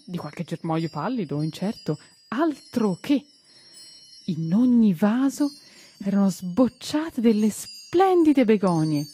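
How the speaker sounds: usual speaking voice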